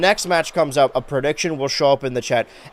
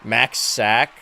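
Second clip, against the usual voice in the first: usual speaking voice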